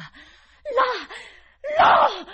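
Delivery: very high, very loud scream; explosive, piercing cry; frantic shout. A narrator's reading. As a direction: scream each word out separately. Governess points Miles to the window.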